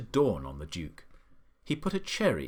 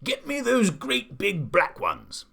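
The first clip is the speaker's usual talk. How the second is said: high, loud, slow demand; old man shouting; 7 semitones higher, 5 dB louder, slower than usual. A narrator's reading. Old man orders cherries from gardener, shouting. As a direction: shout it slowly, each word insisted on. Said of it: "the man was shouting".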